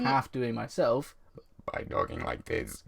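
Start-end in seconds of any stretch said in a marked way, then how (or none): 1.7-2.8 low flat robot voice. Pretend. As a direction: speak like a stiff robot voice. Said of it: "I probably still sound like a robot, which I was half doing myself".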